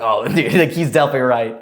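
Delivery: playfully awed agreement; mock impressed. Acted awe at a British accent.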